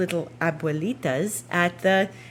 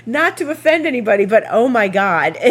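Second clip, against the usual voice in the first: laughing; 4 semitones higher, 7 dB louder than usual. Real, not acted. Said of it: "I burst out hysterical laughing".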